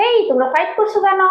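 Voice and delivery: high-pitched scolding question; scolding pets. Real, not acted. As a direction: tell off squabbling pets. Scolding birds for fighting.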